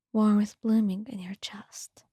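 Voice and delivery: ordinary talk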